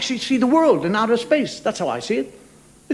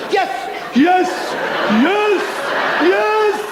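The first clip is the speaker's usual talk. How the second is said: much higher, rising hammed-up "yes" cries; mock ecstatic yes; 11 semitones higher, much slower than usual. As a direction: climb each yes like a fake climax. Mocking orgasm cries.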